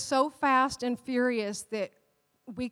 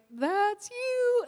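a gentle voice from above pointing at him; Holy Spirit's voice; 9 semitones higher than usual. Retold or imitated. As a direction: voice of the Holy Spirit, much higher and in another timbre. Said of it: "the Holy Spirit was like".

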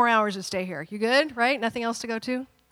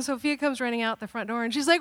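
usual speaking voice

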